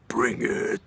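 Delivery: imitating a big drunk fighter, loud and stretched; mock roar, daring someone to fight; belligerent challenge. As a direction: belt it out as a loud dare. Big drunk friend accepts fight.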